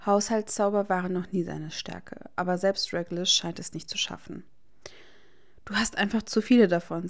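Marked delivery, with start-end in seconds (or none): 5.6-7.1 annoyed. A narrator's reading. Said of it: "sagt er verärgert".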